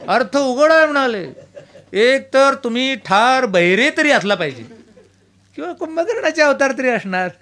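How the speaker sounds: mock scolding. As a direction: wag a finger at the sleepy host. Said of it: "मलाच धमकी देऊन विचारायला लागले".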